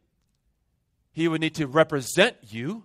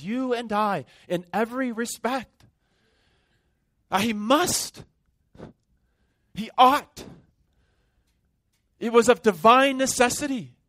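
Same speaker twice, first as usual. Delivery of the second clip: preaching at full force; 7 semitones higher than usual. Acted. Pastor urging the congregation, impassioned and raised. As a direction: drive the point home with rising fervor.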